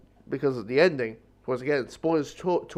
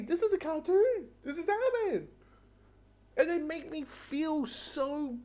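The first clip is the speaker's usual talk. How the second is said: much higher, strained near-crying voice; choked up; 13 semitones higher, 3 dB quieter than usual. Real, not acted. Reviewer overwhelmed by a cartoon, voice breaking. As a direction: voice cracking with emotion, slowing.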